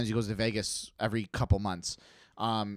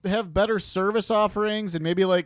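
light mock cab driver voice; 6 semitones higher than usual. South Park taxi cab guys.

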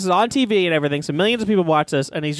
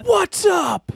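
shouted catchphrase; 13 semitones higher than usual. Shown as a demonstration. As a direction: yell it to the whole arena. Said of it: "No, it's not like that".

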